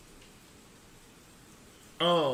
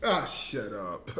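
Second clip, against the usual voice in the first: mock grumble; 12 semitones lower, 7 dB quieter, much slower than usual.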